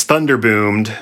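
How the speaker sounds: usual speaking voice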